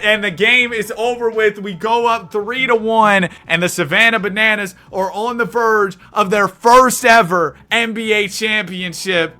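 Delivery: ordinary talk, nothing marked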